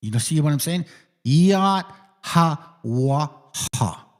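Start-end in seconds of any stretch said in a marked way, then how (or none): 1.2-4.1 "Yad Ha Wah Ha" very slowly, pausing between syllables; closest pronunciation. Shown as a demonstration. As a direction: stretch "Yad Ha Wah Ha" one syllable at a time. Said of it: "closest way you can say that".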